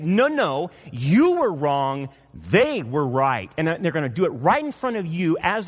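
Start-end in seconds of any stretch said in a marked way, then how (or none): 0.0-3.5 slow humbled admission. Retold or imitated.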